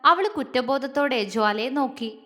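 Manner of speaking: ordinary delivery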